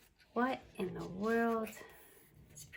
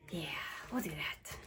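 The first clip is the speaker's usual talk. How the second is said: whispering; 4 semitones lower, 6 dB quieter than usual. Real, not acted. Quiet aside to herself.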